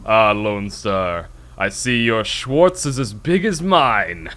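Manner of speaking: slow, loud mock villain drawl; Spaceballs villain quote. Quoting a movie villain to a friend, slow mock taunt. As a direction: ham up the villain's taunt, slowly. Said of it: "The guy's like".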